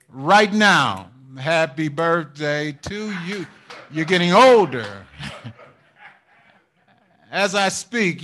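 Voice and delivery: loud sermon delivery with chuckles; preaching. Acted. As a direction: drive it home to the congregation. Preaching with rising intensity.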